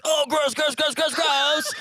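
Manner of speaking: high squealing disgust; disgusted tank driver. Acted. Tank driver squeals at the worm in the cannon. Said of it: "the dude running the tank was like".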